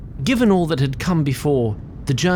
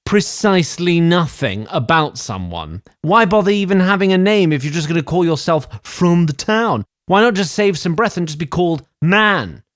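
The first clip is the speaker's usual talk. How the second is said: louder, higher, incredulous scoffing; exasperated rant; 5 semitones higher, 4 dB louder than usual. Acted. Tree sprite narrator mocking the name Vanderberg, exasperated. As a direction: scoff at how pointless the name is.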